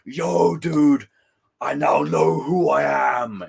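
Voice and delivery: slow, loud surfer-dude shout; mock Venom surfer. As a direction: ham up a dopey surfer Venom. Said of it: "bloody Venom going".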